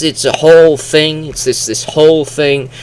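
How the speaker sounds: normal talking voice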